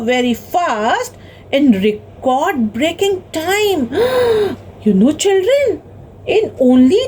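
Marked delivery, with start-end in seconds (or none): 3.9-4.5 sharp storyteller's gasp of wonder; sudden high intake of breath, amazed. Acted. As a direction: gasp in acted amazement for the children. Storyteller amazed at record time.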